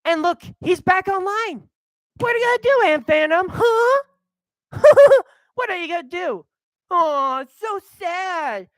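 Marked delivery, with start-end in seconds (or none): 2.2-6.5 high, loud teasing taunt with laugh; taunting Aunt Venom, gleefully mocking. Real, not acted. Taunting a YouTuber. 6.9-8.7 slow, high sarcastic sympathy; mock pity for Aunt Venom, sarcastic.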